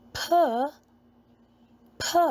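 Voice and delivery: ordinary talk, nothing marked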